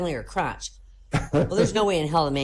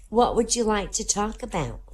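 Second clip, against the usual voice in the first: lightly voiced girl, pitched up; little girl; 5 semitones higher than usual. Girl answering a stranger on the plane, lightly voiced little girl. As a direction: ask as a composed little girl. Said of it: "the little girl".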